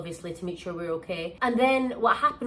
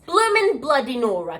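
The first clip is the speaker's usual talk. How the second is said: normal talking voice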